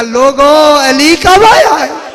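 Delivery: loud, drawn-out call to the crowd; shouting out. Retold or imitated. Blind man crying out to the crowd, loud and slow. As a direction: cry out to the whole bazaar, slowly. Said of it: "चिल्ला के कहने लगा".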